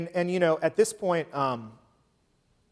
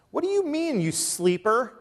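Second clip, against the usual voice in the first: high-pitched, indignant rebuke; acted exasperated demand; incredulous captain; 9 semitones higher than usual. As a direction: rouse a sleeper in high-pitched disbelief. Captain rousing sleeping Jonah, acted.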